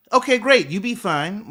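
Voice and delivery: high, loud opening easing off; acted brisk acceptance. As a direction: play a partner readily backing off. Acts partner's reply to brush-off.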